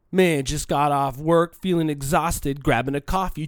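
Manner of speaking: lightly mock a tired showoff post; mocking humble-bragger. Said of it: "the next post is".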